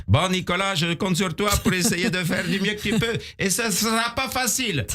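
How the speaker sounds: put-on Romanian accent. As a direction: play the kindly job-centre clerk with a Romanian accent. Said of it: "Mais avec l'accent roumain".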